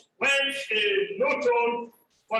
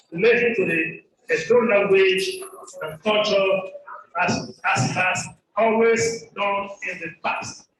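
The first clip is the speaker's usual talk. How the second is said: loud, measured reading of a formal document; formal reading aloud; formal report reading; 6 dB louder than usual. Acted. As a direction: read it out to the hall like an official statement.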